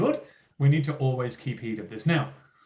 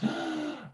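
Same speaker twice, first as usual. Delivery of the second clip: sharp high gasp of fake horror; mock horrified gasp; 13 semitones higher, 7 dB quieter than usual. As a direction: gasp as if you heard something shocking. Mock shock at detentions.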